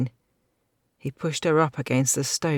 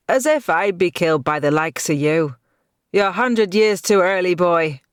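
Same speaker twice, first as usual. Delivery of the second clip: defiant retort; 3 semitones higher, 7 dB louder than usual. A narrator's reading.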